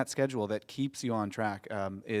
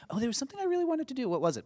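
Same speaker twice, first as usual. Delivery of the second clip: forgetful; 13 semitones higher, faster than usual. Retold or imitated. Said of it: "So you're not like".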